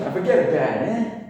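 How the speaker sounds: high, loud mock-Italian accent; New York-style Italian accent, playfully overdone. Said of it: "let's throw in an Italian accent".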